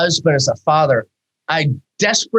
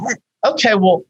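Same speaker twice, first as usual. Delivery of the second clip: flustered excuse; 7 semitones higher than usual. Retold or imitated. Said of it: "You're like".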